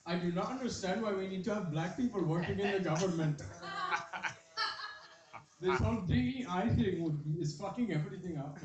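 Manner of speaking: comic imitating Indian workers in an Indian accent; mock Indian accent. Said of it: "They'll just be like".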